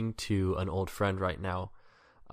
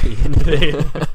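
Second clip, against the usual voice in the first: laughing; 6 semitones higher, 17 dB louder than usual. Real, not acted. Laughing at a bad example.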